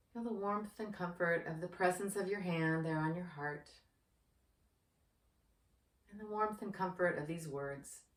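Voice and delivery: soft, unhurried meditation guide voice; guided meditation. Acted. Yoga teacher guides anxious viewers through breathing, in a soft meditation voice. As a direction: ease the listener into calm breathing.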